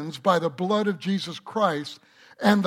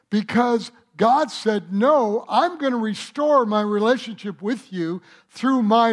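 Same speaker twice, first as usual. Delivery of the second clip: higher, louder pulpit voice; preaching fervently; 4 semitones higher, 4 dB louder than usual. Acted. Pastor preaching God's promise to the congregation. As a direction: build the fire of a preacher.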